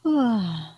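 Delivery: long audible yawn. Real, not acted. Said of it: "Sorry, I'm yawning".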